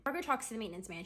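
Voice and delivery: usual speaking voice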